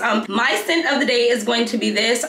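ordinary delivery

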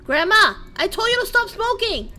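real exasperated yelling; scolding yell. Real, not acted. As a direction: scold grandma for smoking. Scolding grandma.

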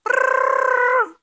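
high, loud, long trill; alarm clock ring. A narrator's reading. Alarm clock wakes the bears, loud trilled ring. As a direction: roll it loudly like a ringing bell.

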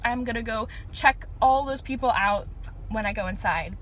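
usual speaking voice

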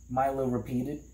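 ordinary delivery

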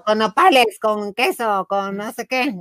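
high, rapid vendor listing toppings. Retold or imitated. Vendor offering toppings to customer, high call. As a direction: rattle off the toppings like the stall lady. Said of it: "Y luego".

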